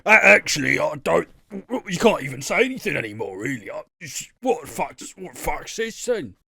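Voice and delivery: lightly voiced blustering older bloke; a hint of an indignant Gavin; grumpy Gavin. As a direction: huff like a put-out uncle at the pub. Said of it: "he's turning into a Gavin already".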